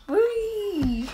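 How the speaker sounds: high delighted whee; gleeful squeal. Real, not acted. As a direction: squeal like on a ride. Squeal while spinning in chair.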